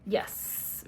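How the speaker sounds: ordinary delivery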